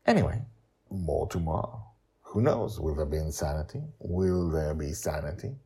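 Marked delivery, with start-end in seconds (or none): none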